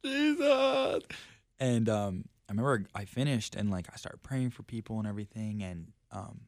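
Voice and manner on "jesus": high, loud exaggerated wail; hammed-up tearful cry to heaven; mock crying out. Retelling crying on the platform, mock wail. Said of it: "I was crying. I was like".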